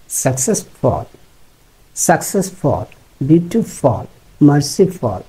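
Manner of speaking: -ful endings said as "fol"; সাকসেসফুল as "saksesfol"; বিউটিফুল with a short "fol". Shown as a demonstration. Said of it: "ফল বলবেন".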